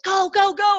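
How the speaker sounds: excited friend cheering her on; urging on. Retold or imitated. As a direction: push her to go. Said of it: "my friend was like".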